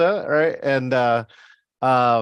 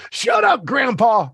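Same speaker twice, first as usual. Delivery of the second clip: high-pitched kid's outburst, hammed up; bratty movie kid; 8 semitones higher, slower than usual. Acting out a kid's movie line.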